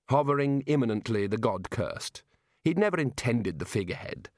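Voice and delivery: ordinary delivery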